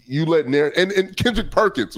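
normal talking voice

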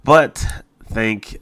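ordinary talk

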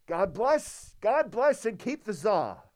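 loud, drawn-out mock blessing for the czar; hammed-up old rabbi praying, building to a shout; Fiddler on the Roof prayer. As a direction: intone it grandly, then belt the punchline. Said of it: "you sounded like the guy from Princess Bride".

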